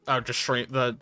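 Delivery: normal talking voice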